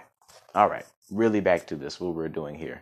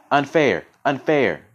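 louder, higher protesting shout; 8 semitones higher, 8 dB louder, slower than usual. A narrator's reading. Little quill protesting to the falcon, shouting. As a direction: call it out in protest, twice. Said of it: "he now shouted loudly".

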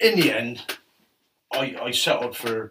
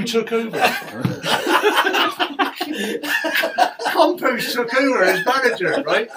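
laughing, pitched well up; 11 semitones higher, 6 dB louder than usual. Real, not acted. Telling how his old rival became boss, laughing. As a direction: break into laughter at the twist.

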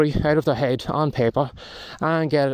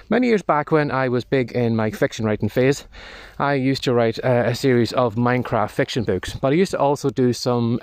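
normal talking voice

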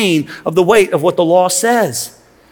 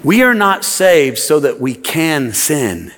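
very slow, deliberate, lower; slow emphatic restatement; 6 semitones lower, much slower than usual. Shown as a demonstration. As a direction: spell out each word slowly. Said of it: "Let me say it this way".

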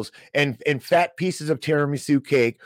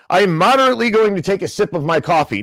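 mock Fed-speak; 6 semitones higher, 7 dB louder, faster than usual. Mocking Fed jargon.

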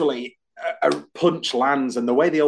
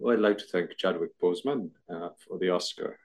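put-on low soft Welsh accent; a bad Anthony Hopkins Welsh voice; 6 semitones lower, 7 dB quieter than usual. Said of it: "I can do Anthony Hopkins".

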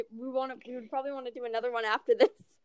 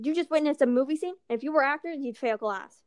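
ordinary talk

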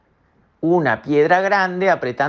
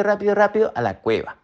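ordinary delivery, nothing marked

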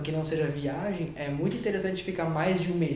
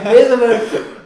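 laughing out the words; bursting into laughter; 5 semitones higher, 15 dB louder, slower than usual. Real, not acted. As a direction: laugh through it, much louder. Laughs while answering.

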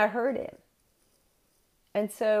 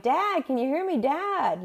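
calling out; 7 semitones higher than usual. Retold or imitated. Calling dad on static line.

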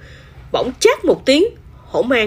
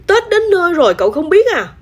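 high, quick disbelief; incredulous, raised-pitch reaction; astonished question; 9 semitones higher, 4 dB louder, faster than usual. A narrator's reading. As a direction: ask it amazed, rushing upward in pitch. Pot amazed kitten knows nothing.

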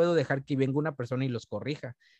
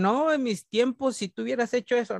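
scolding elder; 9 semitones higher than usual. Retold or imitated.

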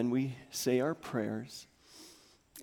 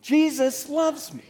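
high, louder and slow; 13 semitones higher, 7 dB louder than usual. Shown as a demonstration. Pastor urging congregation to say it louder. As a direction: push it out louder still. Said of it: "Say it louder".

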